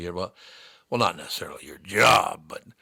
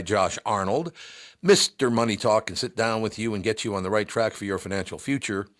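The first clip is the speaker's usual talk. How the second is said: ordinary talk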